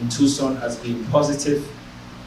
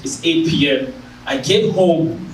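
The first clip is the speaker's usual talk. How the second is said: usual speaking voice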